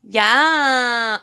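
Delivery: high, half-hearted mock of a needy woman asking permission; mimicking a rival begging her subscribers for a yes. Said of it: "I can't even do her fucking voice".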